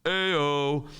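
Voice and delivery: loud, held-out chant; kids' response. Shown as a demonstration. Kids answering the teacher's call, loud chant. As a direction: shout it back as a class. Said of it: "all the kids go".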